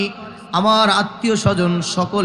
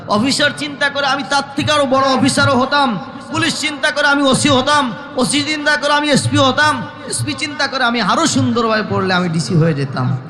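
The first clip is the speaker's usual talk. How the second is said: high, driving list of regrets; forceful preaching; 6 semitones higher than usual. Acted. Preacher voicing officers wishing for higher rank, high impassioned preaching. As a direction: reel off each wish in a high, insistent voice.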